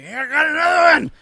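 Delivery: high, loud imitation of a drunk host; mock drunken shout. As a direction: holler it like a party drunk. Imitating a drunk man at party.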